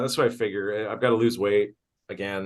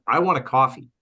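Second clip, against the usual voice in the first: higher, louder cranky demand for coffee; mock coffee demand; 5 semitones higher, 4 dB louder than usual. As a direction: whine "I want a coffee" like a grumpy addict. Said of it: "I'm like".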